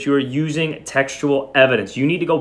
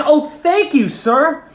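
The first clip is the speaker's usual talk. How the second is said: breathless, much higher and louder thanks; trembling rush; 4 dB louder, slower than usual. A narrator's reading. Martha thanking the principal for the jacket, high excited rush. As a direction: gush it out all at once, overjoyed. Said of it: "I spoke in a trembling rush".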